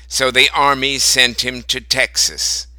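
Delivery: plain reading voice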